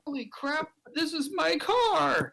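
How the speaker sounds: very high, squealed mock shock; shocked outcry. Woman sees a picture of her blown-up car, shocked. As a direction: draw out the horrified discovery.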